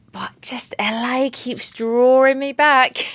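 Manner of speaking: hushed, teasing aside about LA; playful whisper. Real, not acted. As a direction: whisper it like a guilty secret. Playful confession about loving LA.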